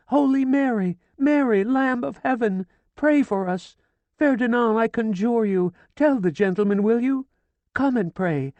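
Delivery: very high, loud frantic cries; hysterical screaming. A narrator's reading. Hysterical widow screaming prayers at the sergeant, frantic. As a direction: scream it out in a frenzy. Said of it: "She was screaming again".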